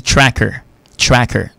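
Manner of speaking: "tracker" with an open A, as in "track"; open-vowelled "tracker", first syllable "trak"; correct vowel demo. Shown as a demonstration. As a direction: say "tracker" carefully with a clear "ah" vowel. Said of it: "c'est la prononciation".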